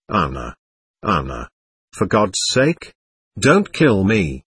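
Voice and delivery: low, hushed plea, slowing down on the last words; begging. A narrator's reading. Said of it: "he was begging her".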